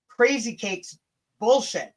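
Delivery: ordinary talk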